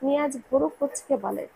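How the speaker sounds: ordinary delivery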